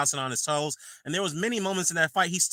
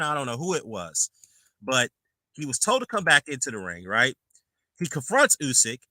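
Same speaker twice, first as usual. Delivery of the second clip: usual speaking voice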